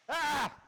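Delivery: sharp high shout of alarm; startled cry. Real, not acted. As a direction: yelp out suddenly, loud and high. Sudden loud cry.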